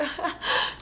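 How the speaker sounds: high, breathless fake giggle; mock laugh to cover up; imitated nervous laugh. Character's nervous laugh acted out.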